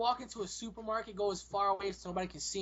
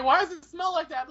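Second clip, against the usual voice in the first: mock complaint; 8 semitones higher, 6 dB louder than usual. Acted complaint in theater.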